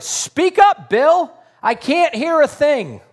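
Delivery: loud, high-pitched holler; shouted. Retold or imitated. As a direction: shout it out as if unable to hear. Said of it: "he shouted".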